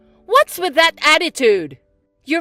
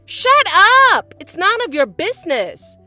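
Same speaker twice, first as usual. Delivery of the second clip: sharp, high, loud yell; angry shout; 10 semitones higher, 13 dB louder than usual. Acted. Stepsister shouting at her stepsister, furious. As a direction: snap at her to shut up.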